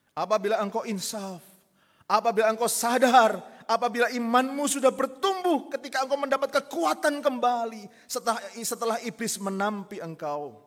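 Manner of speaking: loud, high rising preaching build; fervent preaching. Acted. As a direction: exhort the congregation with rising force. Preacher at full force.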